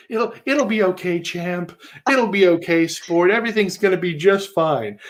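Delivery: pat him on the head with your voice; cloying, babying comfort; toddler talk. Acted. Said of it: "toddler language".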